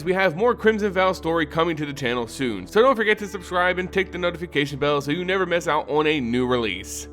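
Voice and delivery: ordinary delivery, nothing marked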